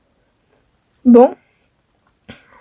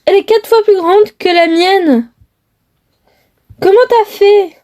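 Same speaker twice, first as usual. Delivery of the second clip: higher, excited disbelief; amazed girlfriend; 5 semitones higher than usual. Acted. Girlfriend amazed at her boyfriend's huge house, excited. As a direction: gasp at how huge the house is.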